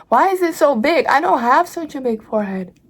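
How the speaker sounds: high, loud frustrated whine; exasperated outburst. Real, not acted. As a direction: whine it in dismay. Complains about big forehead.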